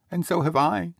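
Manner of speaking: put-on very high, eager child's exclamation; exclaiming child. A narrator's reading. Another child agreeing with Peter, high exclamation. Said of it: "exclaimed another".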